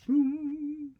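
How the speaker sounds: loud, held high boom; ringing vocal boom; high sung boom. Acted. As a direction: sing out a page-turn sound effect. Sound effect for page turn.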